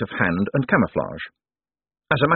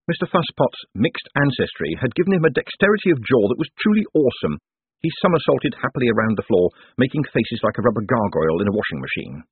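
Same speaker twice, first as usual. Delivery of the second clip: plain reading voice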